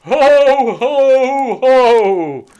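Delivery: big booming ho ho ho; Santa laugh. A narrator's reading. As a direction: belly laugh like Father Christmas. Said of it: "laughed Santa".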